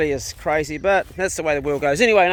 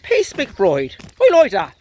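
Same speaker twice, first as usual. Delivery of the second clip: high, proud mock Irish brogue; 6 semitones higher, 7 dB louder, much slower than usual. Owner approving his dog's new name, Irish accent. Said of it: "Pace talks with an Irish voice".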